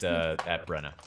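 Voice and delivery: ordinary delivery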